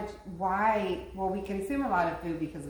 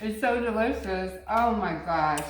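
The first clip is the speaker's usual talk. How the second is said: speaking through a mouthful; chewing while talking; mouth full; 4 semitones higher, 5 dB louder than usual. Real, not acted. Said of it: "sorry for eating with my mouth open".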